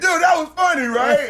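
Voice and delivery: high, loud appeal to buddies; excited guy. Acted. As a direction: push for agreement, high and loud. Guy seeking agreement from his buddies.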